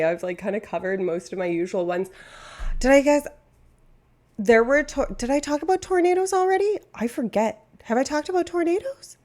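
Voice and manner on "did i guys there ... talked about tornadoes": excited. Real, not acted. High excited question.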